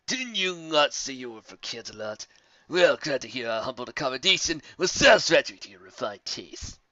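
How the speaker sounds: a hint of a sarcastic bully; sneering. Gar taunting the ponies about Canterlot.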